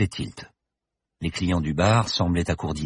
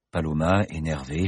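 plain reading voice

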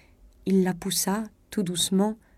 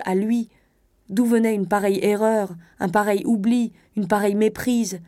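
usual speaking voice

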